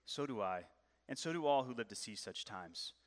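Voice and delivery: lightly voiced grave wizard; a hint of solemn old Gandalf; wise Gandalf. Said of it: "And Gandalf the wise says".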